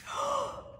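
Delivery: quick high intake of breath; sudden high gasp; sharp high gasp. A narrator's reading. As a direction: gasp in mock alarm. Gasp before the fall.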